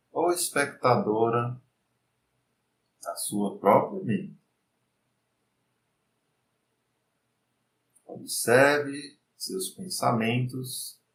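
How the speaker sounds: slow, soft meditation voice; guided meditation. Acted. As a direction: lead the mind calmly, unhurried.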